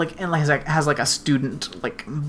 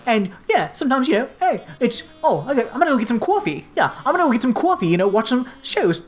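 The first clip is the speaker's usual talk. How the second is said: fast, higher mock New York gangster accent; terrible American accent; 4 semitones higher, faster than usual. Imitating the reporter kid's bad American accent, fast and high. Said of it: "terrible American accent".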